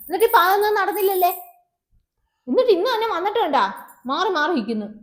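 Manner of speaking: wife raging at her husband's broken promises; angry outburst. Acted. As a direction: tear into him.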